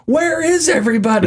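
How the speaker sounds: high, lost and bewildered; confused soldier. Retold or imitated. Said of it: "one of the characters turns to the other and goes".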